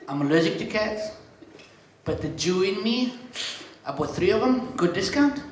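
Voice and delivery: usual speaking voice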